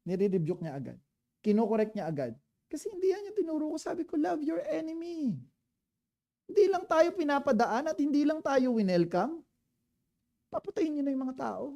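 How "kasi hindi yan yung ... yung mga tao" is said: much higher, fast scolding voice; lightly voiced Jesus chiding James and John.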